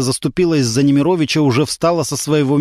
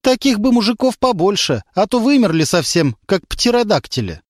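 ironic jab; 7 semitones higher than usual. A narrator's reading. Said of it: "с иронией добавила".

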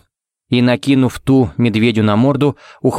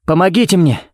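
higher-pitched call for help; cried out; 7 semitones higher than usual. A narrator's reading. Carrying syringes to the wounded bear, urgent cry for help. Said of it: "крикнул он".

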